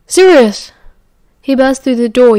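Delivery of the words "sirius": loud, higher shout, falling away; urgent raised-voice call; yelling. A narrator's reading. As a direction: yell his name through the house.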